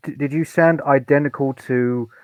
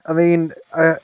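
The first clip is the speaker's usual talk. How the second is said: ordinary talk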